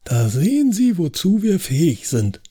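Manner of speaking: deutlich höher, triumphierend. Acted. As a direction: Edgar trumpft siegesgewiss auf. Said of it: "wandte sich Edgar triumphierend an mich". (triumphierend und hoch)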